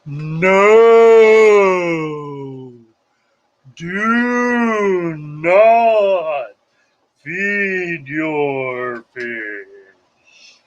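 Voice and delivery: long, drawn-out, swelling wise proclamation, very slow at the end; solemn mock sage slowly saying no; Father Fish voice. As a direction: deliver Father Fish's warning slowly and grandly. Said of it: "And Father Fish says".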